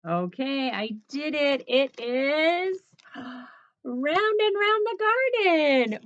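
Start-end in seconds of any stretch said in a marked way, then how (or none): 1.7-6.0 gasping, slow, higher and louder reveal; hammed-up gasp of delight at the pick; mock surprise. Shown as a demonstration. Teacher revealing the chosen ritual to children with mock surprise.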